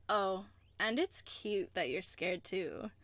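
ordinary talk

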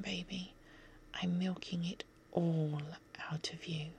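ordinary delivery

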